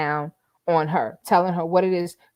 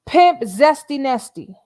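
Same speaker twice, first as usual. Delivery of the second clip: loud, slow, higher hype-man call; hype name announcement; 7 semitones higher, 7 dB louder than usual. Pretend. Streamer crowning Nesto with a new name, loud slow hype call. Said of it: "That's Nesto's new name".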